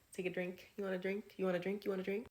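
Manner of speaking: high-pitched, over-eager mock offer; awkward offering. A man with a cup offering drinks round the castle, awkwardly. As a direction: imitate a suspiciously awkward host pushing a drink. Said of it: "super awkwardly with his cup, like".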